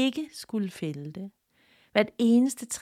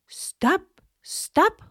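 high, calling shout of a tree; the birch cries out to stop the axe; a high rising cry; 11 semitones higher than usual. A narrator's reading. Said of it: "råbte træet".

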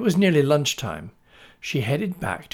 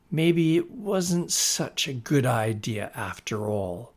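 ordinary delivery, nothing marked

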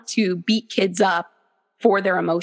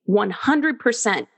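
normal talking voice

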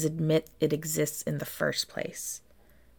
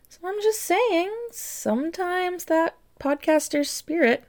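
much higher, sing-song and louder; coy wink at the listeners; playful teasing; 13 semitones higher, 4 dB louder than usual. Acted. Host nudging listeners to leave ratings, high sing-song tease.

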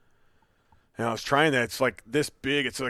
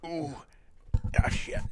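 pained, strained by a leg cramp; wincing through a sudden cramp; hamstring cramp; 5 semitones higher, 4 dB quieter, slower than usual. Real, not acted. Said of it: "I'm cramping bad".

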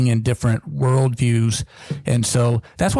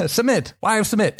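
higher, teasing "Submit"; mock commanding; 7 semitones higher than usual. Shown as a demonstration. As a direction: tease the wife with a mock order. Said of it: "How fun".

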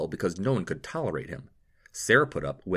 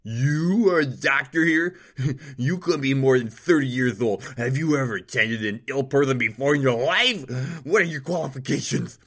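a higher, louder, haughty nobleman sneering at everyone; arrogant nobleman; 7 semitones higher, 8 dB louder than usual. A narrator's reading. Nobleman scorning the city's healers, loud and haughty. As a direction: spit the insults like a spoiled lord. Nobleman mocks young doctor.

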